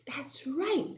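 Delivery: breathy whisper of approval; whispered praise. Acted. Teacher whispering praise to the class. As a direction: hush it, a secret "that's right".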